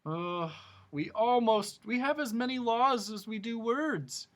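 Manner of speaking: grumbling king. A narrator's reading. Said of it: "grumbled the king".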